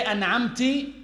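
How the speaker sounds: "an'amti" ending on an i vowel; kasra ending. Shown as a demonstration. Teacher showing an invalid feminine form, kasra ending. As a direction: finish "an'amti" on -ti instead of -ta. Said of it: "you are addressing a female".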